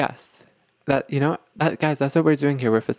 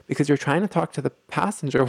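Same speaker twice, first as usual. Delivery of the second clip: ordinary talk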